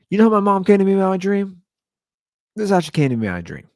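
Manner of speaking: normal talking voice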